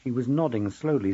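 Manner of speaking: ordinary delivery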